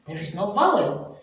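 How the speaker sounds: ordinary delivery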